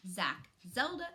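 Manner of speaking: ordinary delivery, nothing marked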